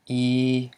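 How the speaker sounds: "e" held long as "ee" on a level pitch; elongated vowel. Shown as a demonstration. Teacher shows learners the live syllable, "e" elongated as "ee". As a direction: stretch "e" into a steady "ee". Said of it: "it's an elongated vowel".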